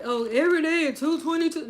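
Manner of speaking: mock routine. Host mimicking a fixed go-live time, mockingly. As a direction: play up a tiresome daily announcement. Mocks keeping a fixed schedule.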